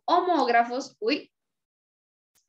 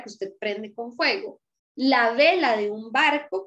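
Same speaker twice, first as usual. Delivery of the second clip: ordinary delivery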